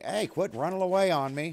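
calling out. Real, not acted. Yelling after fleeing dog.

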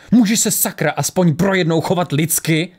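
high, indignant outburst; exasperated shout. A narrator's reading. Lisbeth scolds Ari for being heartless, exasperated cry. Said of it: "křikla Lisbeth".

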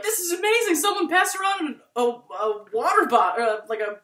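high, loud burst of delight; excited. Retold or imitated. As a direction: gush about it, bright and high. Friend recalls excited period talk.

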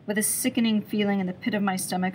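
usual speaking voice

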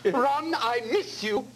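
mock Thatcher pining for Ron; slow mock Thatcher lament; Margaret Thatcher. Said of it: "like Margaret Thatcher on steroids".

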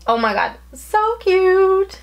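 gushing. Real, not acted. Gushing over the invoice.

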